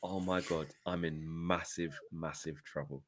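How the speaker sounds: lightly acted inner dread; mock dread. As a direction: mutter it as panic sets in. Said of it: "you see his brain just realizing".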